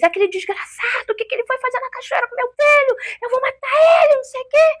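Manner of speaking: high, desperate yelling at the husband, relived; screaming curses in panic, pitch way up; furious yelling. Retold or imitated. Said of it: "E eu gritando, gritando no momento". (mãe gritando furiosa com o marido, voz aguda)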